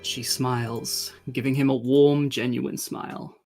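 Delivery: ordinary talk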